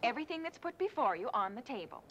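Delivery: ordinary talk, nothing marked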